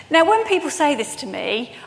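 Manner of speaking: ordinary talk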